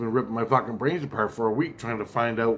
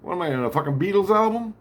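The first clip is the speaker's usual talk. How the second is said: higher, louder disbelief; incredulous; 8 semitones higher, 4 dB louder than usual. Retold or imitated. Reacting to hearing [name] is coming, high disbelief. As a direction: scoff like it's a bad joke. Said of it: "I'm like".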